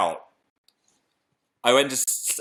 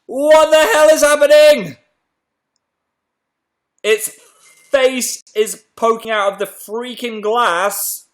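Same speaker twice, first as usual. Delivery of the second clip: high, very loud horrified yell; shrieked shock; 12 semitones higher, 10 dB louder than usual. Real, not acted. Host revealing the hatching egg, shrieked shock. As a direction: shriek it in over-the-top alarm.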